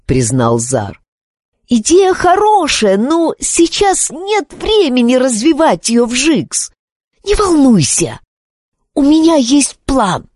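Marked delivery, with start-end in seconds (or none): 1.7-10.3 put-on high, confident boy voice; Xar voice. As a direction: reassure with bright confidence. Xar reassures Wizzix. (уверенный мальчишеский голос)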